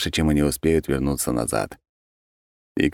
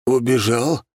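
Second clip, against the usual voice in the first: bewildered; 7 semitones higher, slower than usual. Acted. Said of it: "растерянно произнёс охреневший Генри".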